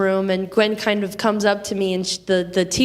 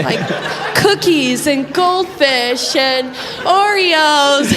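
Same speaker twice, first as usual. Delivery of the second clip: laughing; 4 semitones higher, 4 dB louder, much slower than usual. Real, not acted. Laughing at the snacks.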